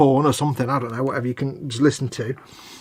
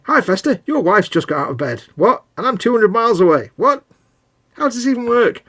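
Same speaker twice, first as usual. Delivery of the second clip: lightly voiced, higher, puzzled caller; mock phone call; 8 semitones higher, 4 dB louder than usual. A friend mocks a phone call about the boiler, lightly voiced and high. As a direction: play the baffled man told about his own boiler. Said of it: "It's like".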